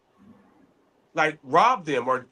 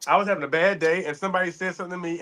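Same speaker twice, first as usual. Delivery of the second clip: ordinary talk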